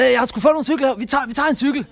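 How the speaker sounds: put-on high, loud drunken yell across the platform; drunk shout across station. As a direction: call out to a friend far away, tipsy and excited. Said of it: "så råber han, min kammerat fra den anden side af stationen råber han". (beruset råb henover perronen)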